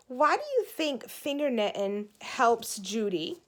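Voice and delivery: ordinary delivery, nothing marked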